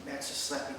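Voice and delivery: ordinary talk, nothing marked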